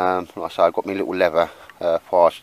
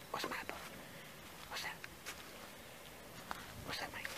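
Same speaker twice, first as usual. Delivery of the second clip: whisper; 24 dB quieter, faster than usual. Real, not acted. Whispering to the dog in woods.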